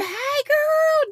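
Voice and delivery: very high delighted squeal; squealed greeting. Real, not acted. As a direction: greet a friend with a happy shriek.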